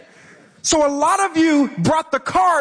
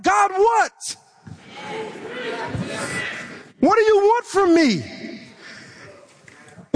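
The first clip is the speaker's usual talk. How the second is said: higher, pressing exasperation; frustrated cry to God; 5 semitones higher than usual. Retold or imitated. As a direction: plead with God, worn out and impatient. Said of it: "so frustrated".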